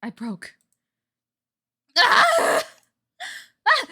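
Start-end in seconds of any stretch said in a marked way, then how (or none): 2.0-3.9 sharp high scream on waking; terrified wake-up scream. Acted. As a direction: jolt awake screaming.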